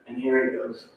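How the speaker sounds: ordinary talk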